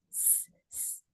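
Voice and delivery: स- as voiceless hiss of air; airy sibilant demo. Shown as a demonstration. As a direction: let the air rush out on स-. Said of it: "air flow के साथ पढ़ रहे".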